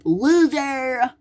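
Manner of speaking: high mocking shout; high mock taunt. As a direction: jeer at him. Taunting the toy.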